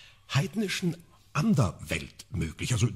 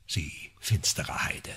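slow, low mock-sinister whisper; a lower, slow stage whisper to a friend; teasing whisper; 8 semitones lower, 4 dB quieter, slower than usual. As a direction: whisper it as a playful accusation. Teasing a companion.

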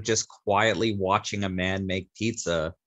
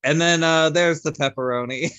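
mock game-show host, higher and much louder; imitating a hammed-up host; 8 semitones higher, 7 dB louder than usual. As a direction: announce the pepperoni like a cheesy host. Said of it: "Then he's like".

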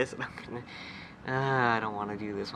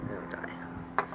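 whispering; 5 semitones lower, 8 dB quieter than usual. Real, not acted. Whispered threat to a bug.

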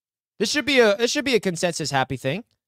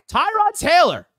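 a name called out higher, louder and slow; excited drawn-out name; 11 semitones higher, 4 dB louder, slower than usual. Real, not acted. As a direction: savor the name like a celebration. Celebrating the signing on stream.

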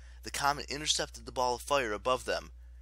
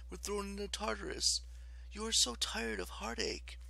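high, sorrowful coaxing; kind, sympathetic; 10 semitones higher than usual. A narrator's reading. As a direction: soothe the goddess with pity. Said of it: "her voice radiating kindness and sympathy".

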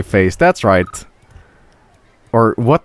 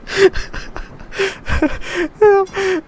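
high-pitched laughing fit; helpless laughter. Real, not acted. Cracking up at the wrecked park.

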